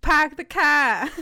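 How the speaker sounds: put-on loud, slow Boston accent, dropped r; hammed-up Boston "Park the car". Friend shows off a Boston accent, loud and slow. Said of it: "You wanna hear a Boston accent?".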